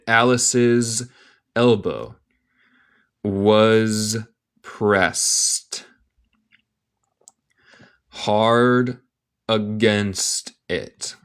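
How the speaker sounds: word by word with long pauses; slow careful reading. Shown as a demonstration. English teacher reading a story to learners, slowly and in pieces. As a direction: give learners each word separately.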